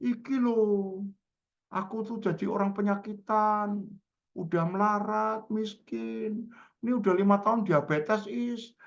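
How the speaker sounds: high, slow, plaintive mock lament; changed, woeful intonation.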